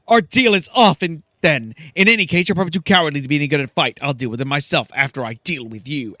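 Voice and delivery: angry Silke. Acted. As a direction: turn on the cowards who refused. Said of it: "She says".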